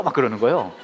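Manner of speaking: ordinary talk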